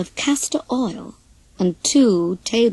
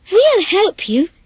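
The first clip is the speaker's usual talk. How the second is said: high, eager chick voices; cheeping chicks; 9 semitones higher, 4 dB louder, slower than usual. Acted. Chicks to the hen, offering help, high eager cheep. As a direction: chirp it up brightly. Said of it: "cheeped the chicks".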